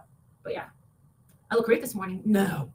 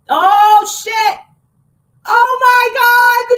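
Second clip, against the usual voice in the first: loud, higher cry of shock, drawn out on the last words; real shocked outburst; 9 semitones higher, 12 dB louder, much slower than usual. Real, not acted. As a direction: blurt it out, stunned and loud. Reacting to a shocking text.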